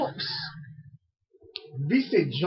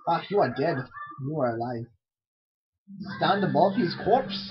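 normal talking voice

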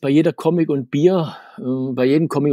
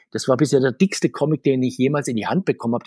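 normal talking voice

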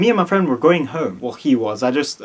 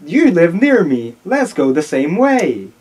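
slow, exaggerated sing-song greeting; acted greeting to Becky; 4 semitones higher, 4 dB louder, slower than usual. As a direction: draw out each word, higher and louder. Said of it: "He approaches Becky and says".

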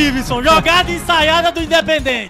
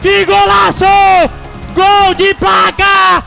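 screaming goal call at full power; explosive goal commentary; 10 semitones higher, 10 dB louder, slower than usual. Real, not acted. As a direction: erupt into a long ecstatic goal shout. Goal scored, commentator erupts.

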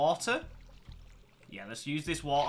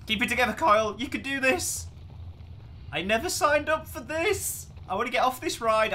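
higher, louder frightened pleading; scared; 9 semitones higher, 5 dB louder than usual. Real, not acted. Player on a terrifying minecart, scared pleading. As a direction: talk yourself through the panic. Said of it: "I'm scared, guys".

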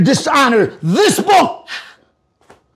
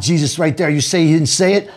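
normal talking voice

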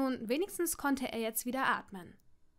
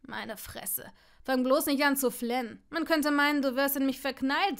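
louder, scornful mocking; loud jeer; 2 semitones higher, 6 dB louder than usual. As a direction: heckle him openly. Malfoy jeers at blushing Neville.

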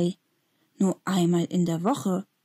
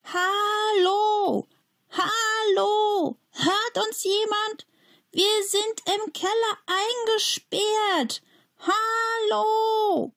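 very high, drawn-out calls for help; calling for help; much slower than usual. A narrator's reading. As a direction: call out as far as you can through the window. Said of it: "brüllt, so laut er kann". (sehr hoch und langgezogen um Hilfe rufen)

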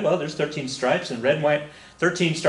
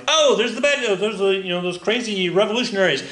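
mock British soldiers; 8 semitones higher, 3 dB louder than usual. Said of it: "the British force are like".

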